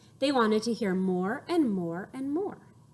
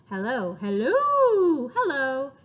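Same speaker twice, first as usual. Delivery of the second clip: drawn-out louder hellos; slow hellos; 3 semitones higher, 5 dB louder than usual. Shown as a demonstration. Showing kids one way to say hello. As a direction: stretch each hello out. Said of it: "say hello three different ways".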